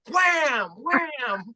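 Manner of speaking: shouted wham, then a cry; headbutt sound effect. Retold or imitated. Retelling the fight, shouting the impact noises. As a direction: hammer out each impact.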